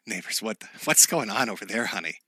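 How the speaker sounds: soft, hushed nosy neighbor; quiet gossiping neighbor. Acted. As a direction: murmur like a neighbor peeking through curtains.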